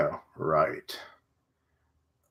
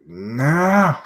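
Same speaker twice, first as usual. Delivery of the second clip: higher, drawn-out cry of dismay; 4 semitones higher, 4 dB louder than usual. Real, not acted. Reader reacting to characters shot in the back, dismayed cry. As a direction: cry out in protest.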